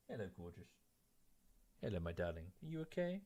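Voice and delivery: hushed and tender, cooing at the dog; soft pet talk. Real, not acted. Streamer coos softly to his French bulldog. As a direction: murmur sweetly to a dog at your feet.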